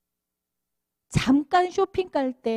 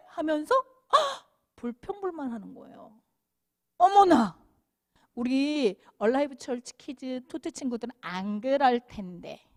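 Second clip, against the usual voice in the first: ordinary talk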